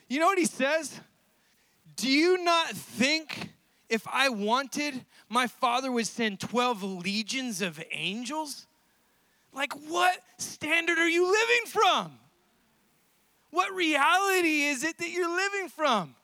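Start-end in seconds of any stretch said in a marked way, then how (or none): 2.0-8.7 slow, weighty rhetorical question; incredulous rebuke. Acted. Jesus to Peter with the sword, slow incredulous rebuke. 9.5-16.2 incredulous challenge. Acted. Jesus challenging Peter's standard, incredulous.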